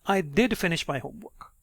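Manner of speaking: "did" a little high, then falling away; stress on DID. Shown as a demonstration. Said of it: "your pitch goes a little high on did".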